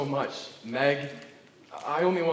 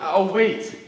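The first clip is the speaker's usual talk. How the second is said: loud, earnest vow; 8 semitones higher, 12 dB louder than usual. Acted. Suitor answers refusal.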